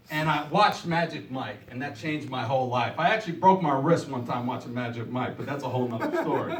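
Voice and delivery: normal talking voice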